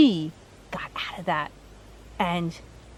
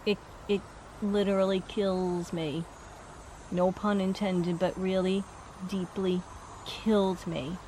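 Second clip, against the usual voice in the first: soft, halting relayed remorse; emotional regret; 2 semitones lower, 8 dB quieter than usual. Retold or imitated. Relaying regret to the one left behind, emotional. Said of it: "He's getting emotional".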